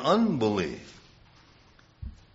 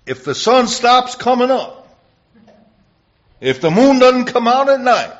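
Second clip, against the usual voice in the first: higher and louder, thundering from the pulpit; preaching shout; 12 semitones higher, 10 dB louder than usual. Acted. Preacher to congregation, proving God keeps Israel, full-force shout. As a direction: build it up and shout the impossible. Preacher drives the point home.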